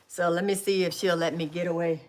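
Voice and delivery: usual speaking voice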